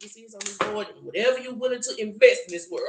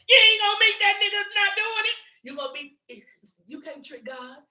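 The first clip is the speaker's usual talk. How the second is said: usual speaking voice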